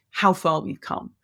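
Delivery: usual speaking voice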